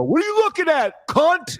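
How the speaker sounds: very high, loud cartoonish challenge; mock confrontation. Host imitating a one-on-one show opener, shrieky challenge. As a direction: shoot way up in pitch, picking a fight. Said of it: "you go".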